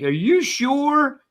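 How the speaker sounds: put-on high, slow kid's question; son's doubtful plea. Son asking his father, high and slow. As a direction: stretch it out like a worried boy. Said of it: "he's like".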